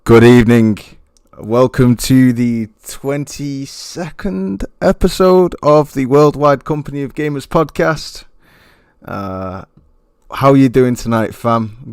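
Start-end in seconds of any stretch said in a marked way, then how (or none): 0.0-0.8 announcer greeting. Acted. Podcast host's opening welcome. 1.4-8.2 show intro. Acted. Host's formal show intro.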